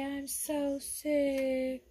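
slow, high whiny sick voice; sick child voice. Pretend. Mom voices her sick daughter for the viewers, slow and whiny. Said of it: "Tell them, say".

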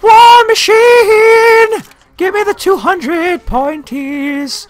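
very slow, drawn-out high call; high battle cry. Pretend. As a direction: stretch it out in a silly high voice. Battle cry on using weapon.

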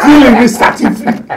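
loud, high laughing delivery. Real, not acted. Joking in animated banter.

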